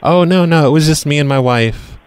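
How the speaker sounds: ordinary delivery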